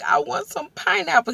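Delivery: high, pleading and tearful; whiny craving. Retold or imitated. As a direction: beg for it like a crying child. Craving pineapple, crying in bed.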